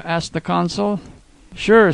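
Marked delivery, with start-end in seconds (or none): 1.5-1.9 cheerful. A narrator's reading. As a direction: toss it off cheerfully. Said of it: "said the kid cheerfully".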